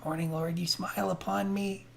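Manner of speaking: soft, high, awed whisper; tiny whisper. Acted. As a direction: whisper it like a private prayer. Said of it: "a tiny whispered moment".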